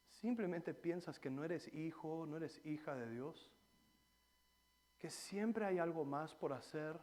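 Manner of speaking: ordinary talk, nothing marked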